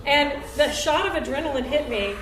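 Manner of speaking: normal talking voice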